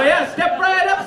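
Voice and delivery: high, loud barker's cry, a bit slow; hammed-up sideshow pitch, belting it; a barker hollering at the crowd at a carnival game, loud and high; carnival barker call. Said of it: "make that into a carnival game".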